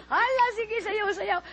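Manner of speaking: high, cheering chant; crowd cheering. Retold or imitated. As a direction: cheer the dancer on. Crowd cheering her dance.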